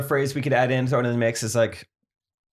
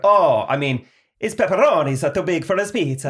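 louder, hammed-up Italian accent; 3 semitones higher, 3 dB louder than usual. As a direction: say it like an Italian guy, punched up. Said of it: "Italian accent, though".

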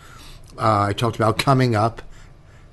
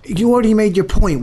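exasperated; 9 semitones higher, 3 dB louder than usual. Real, not acted. Cutting off cohost.

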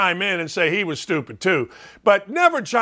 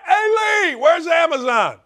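hollering through house; 8 semitones higher than usual. Acted. Husband calling out about the game, hollering through house. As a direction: act out yelling for help from another room. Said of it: "what you're gonna hear in my house".

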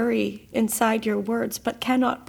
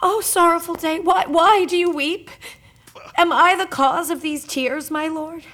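distressed lament; 4 semitones higher, 5 dB louder than usual. Acted. Wife sees husband weeping.

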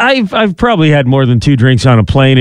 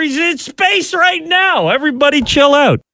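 hammed-up breaking news, much higher; mock excited alert; 14 semitones higher than usual. Joking announcement about Shatner.

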